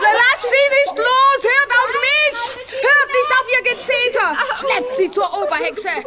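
high shouted commands; shouted orders. Acted. Weather witch orders others.

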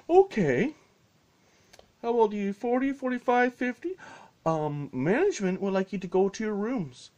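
high, loud scolding voice; mock stern staff. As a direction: scold grown adults like kids.

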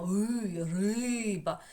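a long sustained "И", then "риба" with stretched РИ; highlighted stressed vowel. Shown as a demonstration. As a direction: hold "И" out, carry it into "риба". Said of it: "ми його виділяємо".

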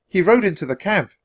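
put-on high, light child's voice; little girl. A narrator's reading. Annie informing the centurion, girlish. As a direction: state it as a small girl.

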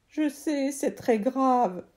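put-on a kid pleading to be heard; boy's voice.

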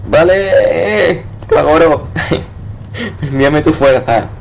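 high theatrical summons; mock invocation. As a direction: call on him like a spell. Invoking streamer's power.

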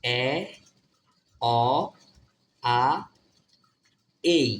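A read as এ, অ, আ, এই, each held apart; four sounds of A. Shown as a demonstration. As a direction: sound out এ, অ, আ, এই one at a time. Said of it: "এ ওর উচ্চারণ কি শিখছিলাম?". (এ, অ, আ, এই আলাদা আলাদা করে উচ্চারণ)

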